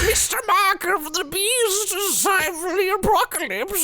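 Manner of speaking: high hammy villain voice; mustache twirling villain. Acted. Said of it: "doing a mustache twirling motion".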